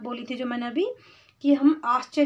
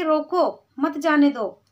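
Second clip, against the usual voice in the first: falling रोको as its own command, then मत जाने दो; pause after रोको. Shown as a demonstration. Stopping a person who is leaving, pause after रोको. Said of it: "तो हम बोलेंगे".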